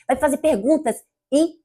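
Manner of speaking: normal talking voice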